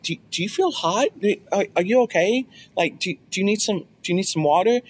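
high, hesitant fussing questions; mock concern. Husband feeling wife's forehead, high mock concern. Teasing wife with feigned concern.

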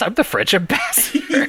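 high-pitched mock indignation, breaking into laughter; mock protest. Acting out the indignant ambassador.

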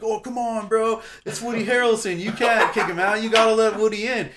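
loud, high-pitched begging to the bouncer; worked-up, whiny-high protest at full volume; drunken pleading. Retold or imitated. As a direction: act out a drunk insisting on his case. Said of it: "I'm, like, all drunk".

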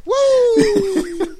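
high, loud laughing whoop. Real, not acted. Laughing friend whoops at the running joke, high and loud. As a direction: whoop it up, cracking up.

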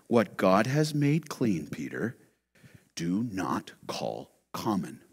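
hushed, low, slow voice of God. Retold or imitated. God answering Peter in the vision, hushed and slow. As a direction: speak God's words softly and gravely. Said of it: "the voice came to him".

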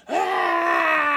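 sudden high scream of mock terror; big fake wail. Cousin faking being caught by La Llorona, high scream. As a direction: scream like you saw a ghost. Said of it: "Let out this big old wail".

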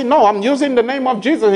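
high, pressing rebuke to the devil; firm rebuke. Retold or imitated. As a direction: stand your ground against the devil. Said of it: "And you say".

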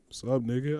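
lightly imitated low, quiet greeting; a hint of the big man's low challenge; challenging greeting. Big man greets him as a challenge, low quiet voice. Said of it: "sounded like a greeting, but was obviously, like, a challenge".

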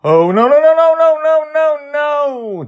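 very high, loud, panicked cries; terrified, out of his wits; frightened. Acted. Charlie frightened out of his wits at the chasing ghosts. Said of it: "frightened out of his wits".

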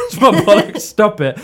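high, loud mock yell with laughter creeping in; imitated shouting. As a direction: act out frantic protest, amused. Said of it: "Steve's just shouting".

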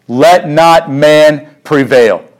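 each word slowed and weighted; slow, deliberate repetition; slow emphatic repeat. Acted. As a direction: drag it out to drive it home.

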